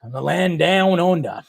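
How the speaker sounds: put-on a clumsy, half-hearted Australian accent that ends in a whisper. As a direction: try the Australian accent, badly, as a joke. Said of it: "I'm working on my Australian accent".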